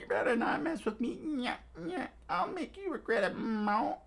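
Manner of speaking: high, squeaky gloating with nyak laugh; smug rat captain. Acted. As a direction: gloat like a greedy little rat. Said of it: "Nezumi ... said".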